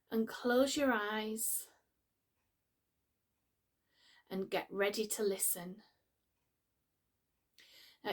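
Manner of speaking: calm, unhurried meditation voice with long pauses; guided meditation. Acted. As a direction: gently guide the listener into relaxation, pausing between phrases.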